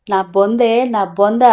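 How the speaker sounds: usual speaking voice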